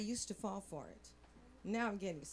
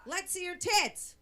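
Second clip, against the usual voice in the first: loud, high, drawn-out heckle; catcall yell; 8 semitones higher, 6 dB louder, slower than usual. Acted. Construction workers catcalling a passing woman, mock yell. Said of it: "They used to yell".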